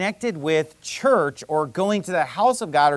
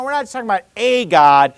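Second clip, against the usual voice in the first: "a" said as a stressed long "ay" in "a God"; 3 semitones higher, 6 dB louder, faster than usual. Shown as a demonstration. Preacher setting any god against the real one, stress on "a". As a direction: lean hard on "a", pronounced "ay". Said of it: "I'm thirsting for the real God".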